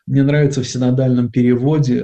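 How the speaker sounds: ordinary talk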